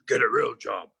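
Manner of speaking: dismissive mock Trump voice; lightly voiced dismissive Trump. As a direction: brush it off like a scornful president. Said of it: "He's gonna go".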